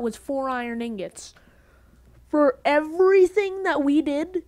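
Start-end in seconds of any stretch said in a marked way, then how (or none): 2.3-4.4 loud, higher indignant outburst; angry rant. Real, not acted. Gamer angry at getting only four ingots, shouting. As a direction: shout the complaint louder and louder. Angry at poor crafting yield.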